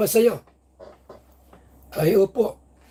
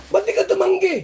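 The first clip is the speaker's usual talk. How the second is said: pitched-up, hammed-up questioning; mock attorney; 9 semitones higher than usual. Vlogger voicing the attorney asking [name], hammed-up and higher. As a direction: fire the question high and showy.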